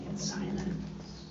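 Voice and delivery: breathy whispered "in silence"; whispered, almost gone. Acted. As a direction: let it fall to a hush. Hushed description of silence.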